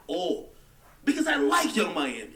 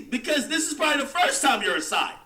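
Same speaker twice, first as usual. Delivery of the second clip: ordinary talk, nothing marked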